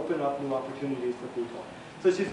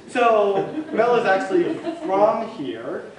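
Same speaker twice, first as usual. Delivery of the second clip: laughing; 3 semitones higher, 8 dB louder, slower than usual. Real, not acted. Host teasing after laughing.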